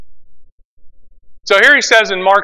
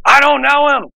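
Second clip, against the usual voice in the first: angry denial; 7 semitones higher than usual. Retold or imitated. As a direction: snap it back like cursing at the accuser. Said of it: "cussed at him".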